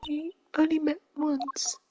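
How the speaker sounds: put-on high, soft, slow girlish murmur; timid girl voice. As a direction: confess it quietly. Shy girl character voice.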